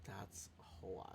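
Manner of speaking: whisper. Real, not acted. Unvoiced whisper after pay.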